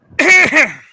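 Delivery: sharp cough to clear the throat. Real, not acted. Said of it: "while you're clearing your throat".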